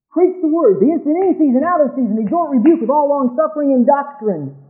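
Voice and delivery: loud, high fiery preacher quoting scripture; preaching scripture. Acted. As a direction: proclaim it like a revival preacher. Quotes scripture at full force.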